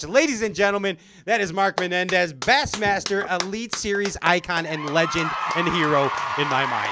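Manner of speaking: booming ring announcer intro, shouted. Acted. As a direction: introduce him like a big-fight announcer. Toasting the guest like an announcer.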